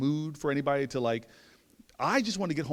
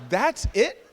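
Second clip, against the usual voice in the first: loud, high disbelief; incredulous; 7 semitones higher, 9 dB louder than usual. Retold or imitated. Man hearing the neighbor's empty advice, incredulous. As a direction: blurt it, badly let down.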